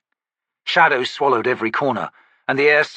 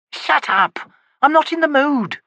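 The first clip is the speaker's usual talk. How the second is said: angry snap; 4 dB louder than usual. Acted. Stonny snaps back.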